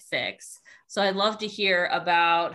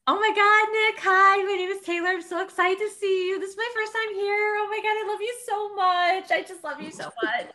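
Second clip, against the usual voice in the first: high, loud, breathless fan meltdown; gushing super fan; 11 semitones higher, 4 dB louder, faster than usual. Pretend. Super fan meeting [name] at a con, high tearful gushing. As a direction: squeal it like an overwhelmed fan, near tears. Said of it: "I'll be super fan".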